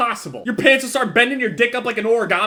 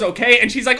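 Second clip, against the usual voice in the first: usual speaking voice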